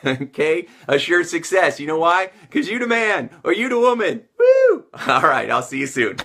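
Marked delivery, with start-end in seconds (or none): 2.5-4.2 hype cheer. Shown as a demonstration. Hyping the viewers at sign-off. 4.4-4.8 very high, loud whoop; excited whoop. Shown as a demonstration. Coach ending the video with a wild cheer.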